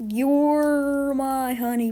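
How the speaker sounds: tender. Pretend. Cooing at game character.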